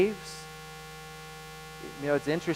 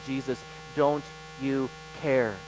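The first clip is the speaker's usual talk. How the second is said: "Jesus, don't you care?" said softer and slower, gently reflective; soft restatement; 4 semitones lower, 6 dB quieter, slower than usual. Shown as a demonstration. Preacher quietly repeating the congregation's question, soft and slow. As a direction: let the question fall quietly.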